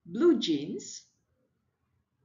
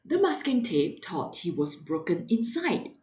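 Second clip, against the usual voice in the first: usual speaking voice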